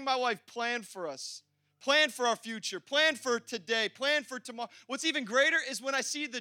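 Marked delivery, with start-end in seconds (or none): none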